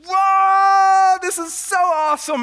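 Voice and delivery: very high, loud awestruck whoa; a child watching the plane soar; kid amazed. Retold or imitated.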